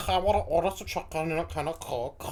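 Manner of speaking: throat-scraping mock Scouse; exaggerated phlegmy K sounds; hocking a loogie. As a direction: imitate the accent as if building up a loogie. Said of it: "Sounds like, just build up a loogie".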